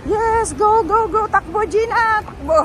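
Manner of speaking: high, loud excited urging; excited cheering. Real, not acted. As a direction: cheer her on to run. Urging Gina to run across.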